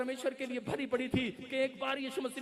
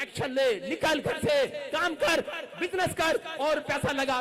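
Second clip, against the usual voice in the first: loud, higher commanding call; preaching shout; 3 semitones higher, 4 dB louder, slower than usual. Acted. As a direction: urge them to act now. Preaching at full force. (ज़ोर से आदेश)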